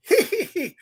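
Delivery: high-pitched hyena giggle; mock hyena laughter. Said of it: "The hyena".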